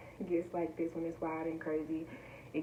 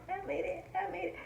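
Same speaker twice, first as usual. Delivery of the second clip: much higher, giddy; high joyful repeats; 12 semitones higher than usual. Real, not acted. Vlogger celebrating her sponsor, giddy high repeats. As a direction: keep squealing it happily.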